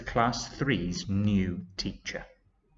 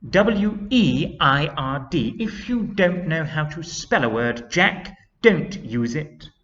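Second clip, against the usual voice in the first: loud, raised, emphatic telling-off; stern teacher; 7 semitones higher, 7 dB louder than usual. A narrator's reading. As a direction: hammer out each letter, then scold. Teacher scolds pupil over spelling.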